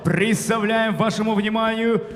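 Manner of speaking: booming ring announcer intro; boxing ring announcer. Acted. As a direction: announce the fighter to the arena.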